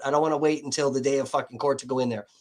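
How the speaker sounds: normal talking voice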